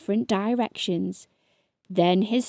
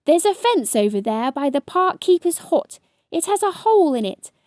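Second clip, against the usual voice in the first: higher, louder urgent commands; urgent orders; 8 semitones higher, 4 dB louder than usual. A narrator's reading. Ladybird steering rolling hedgehog, urgent shout. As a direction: drive him on, faster and louder.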